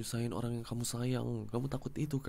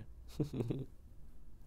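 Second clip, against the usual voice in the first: soft chuckle; 6 dB quieter than usual. Acted. She starts smiling through tears.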